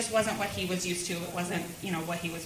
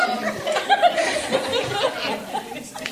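high laughter; 7 dB louder than usual. Real, not acted. Laughing about pastor crying.

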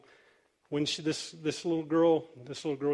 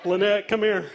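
slightly louder, mock-uneasy call for help; calling someone over; 3 semitones higher, 4 dB louder than usual. Pastor uneasy around crying ladies, calling Lynette over. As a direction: playfully summon backup.

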